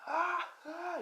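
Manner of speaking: high mock pained cries; a light imitation of screaming; imitated screams. Izuku under torture, high mock cries of pain. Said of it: "Deku screaming agony".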